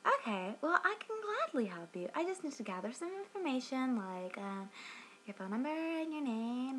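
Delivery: mock helpful rep, pitched well up; Cindy. Customer mimics the rep asking for her details, mock-helpful high voice. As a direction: imitate a syrupy hotline lady, high and swooping. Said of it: "And she's like".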